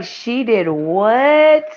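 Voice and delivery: slow, drawn-out disbelief; mock surprise. Boyfriend hearing who put her up to it, in slow disbelief. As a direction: stretch it out in shock. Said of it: "He was like".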